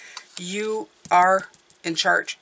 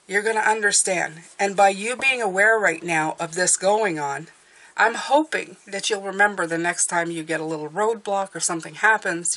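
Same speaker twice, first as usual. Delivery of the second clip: ordinary talk, nothing marked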